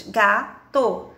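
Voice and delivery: "Gato" split into ga, to; two separate held beats on "Gato"; syllable by syllable. Shown as a demonstration. Teacher counting two syllables with a child, split slowly. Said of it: "abre a boquinha pra falar gato".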